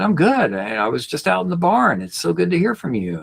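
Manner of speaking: high, cheerful dad voice; voicing dad. Son voices his dad glad to hear from him, high voice. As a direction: lift the pitch well up for Dad.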